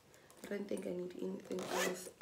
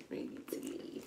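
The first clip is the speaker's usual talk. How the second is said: whisper; 5 semitones higher, 7 dB quieter than usual. Real, not acted. Whispered aside to herself.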